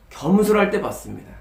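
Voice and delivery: ordinary talk, nothing marked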